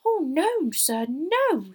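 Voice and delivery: high, loud, emphatic elf voice; squeaky servant elf; earnest house-elf. Acted.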